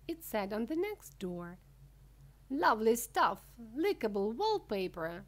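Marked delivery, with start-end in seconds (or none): 2.5-5.2 loud opening, easing off; delighted cry. A narrator's reading. Wonka rushing past his wallpaper door, crying out with delight. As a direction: exclaim with relish, then settle. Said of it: "cried Mister Wonka".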